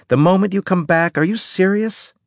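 can't believe what she heard; incredulous. A narrator's reading. Indignant disbelief.

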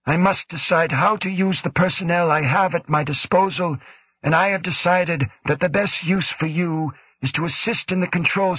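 lightly voiced cool commanding official; stern director. A narrator's reading. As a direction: speak as the stern station director. Woe's long reply.

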